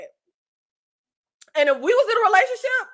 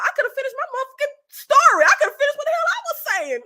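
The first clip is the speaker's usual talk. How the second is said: much higher and fast, heated venting; worked-up rant; 9 semitones higher, much faster than usual. Acted. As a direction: spill it out fast and high. Ranting about being silenced.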